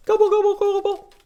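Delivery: very high, loud gobbling call; turkey gobble. Pretend. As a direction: squawk it like a turkey for the kids. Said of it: "what sound does the turkey make".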